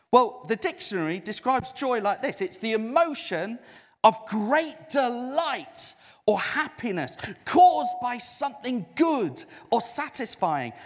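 ordinary talk

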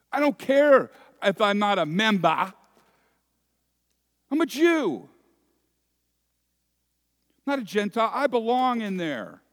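loud, high, furious protest; enraged outsider. Acted. Shut-out guest raging at the master, loud and high. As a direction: rage at being shut out. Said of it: "tears of rage".

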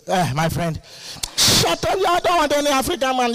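dismissive snap. Retold or imitated. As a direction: brush him off sharply. Woman rejects a suitor.

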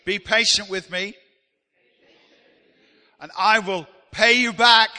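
loud unison lead, rising to a shout; saying it together. Shown as a demonstration. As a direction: lift the congregation into a big shared cry. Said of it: "Can we say it together?".

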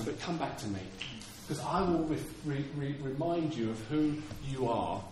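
low, gentle, halting comfort; tender reassurance. Acted. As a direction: speak softly as a loving God to a child. God acted reassuring believers.

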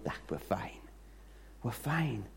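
just woke up, yawning, totally at ease; sleepy calm reassurance. Retold or imitated. Elisha yawns at the window and soothes his servant, relaxed and soft.

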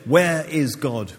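slow, looming question from an austere vicar; stern vicar. Retold or imitated. Vicar pressing a naughty boy across the desk, slow and stern. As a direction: lean in and ask it gravely. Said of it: "the vicar leaned on his desk".

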